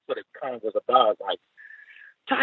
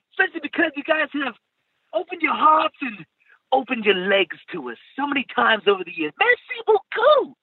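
put-on much higher, gushing posh British accent. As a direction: swoon over Montreal in posh British. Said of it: "my really posh British accent".

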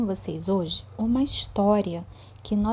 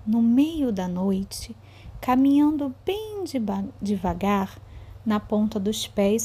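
slow, suspenseful storytelling; reading to children. A narrator's reading. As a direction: draw the kids into a spooky tale. Teacher reads story to children.

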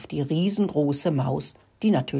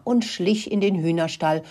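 usual speaking voice

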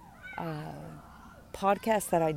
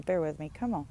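ordinary delivery

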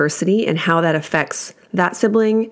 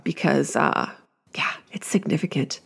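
breathy hushed aside, much softer; whisper; 3 semitones lower, 7 dB quieter than usual. Real, not acted. Admitting to listeners how big this is, in a whisper. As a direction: drop to a whisper as emotion catches. Emotional aside about a child leaving.

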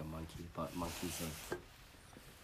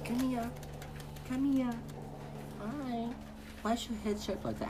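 much higher sing-song call; 4 dB louder than usual. Real, not acted. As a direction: coo to the puppy in a high voice. Calling the puppy over.

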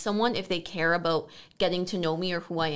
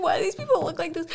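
high, quick, baffled put-on voice; imitating Fred; 10 semitones higher, faster than usual. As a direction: play Fred staring at the ravers. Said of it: "Fred's like".

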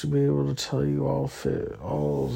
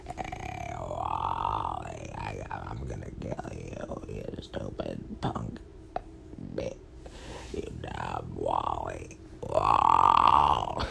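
low, menacing robot; evil robot voice; 3 dB quieter, slower than usual. Acted. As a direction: growl threats as an evil robot. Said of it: "his evil brother robot".

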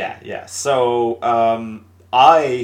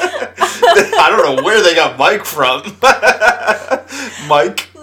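loud, higher, slow through laughter; laughing; 7 semitones higher, 7 dB louder, slower than usual. Real, not acted. Host puzzles over the nickname Mike, cracking up. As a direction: talk through a big laugh.

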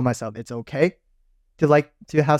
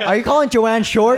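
high, loud teasing accusation through laughter; laughing mock outrage; 10 semitones higher, 5 dB louder than usual. Real, not acted. Friend teases him for calling Joanne short, laughing. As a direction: pounce on the joke, laughing. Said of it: "why would you say that".

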